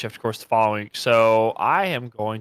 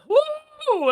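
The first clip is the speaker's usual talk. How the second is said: excited whoop. Real, not acted. Excited whoop at good food.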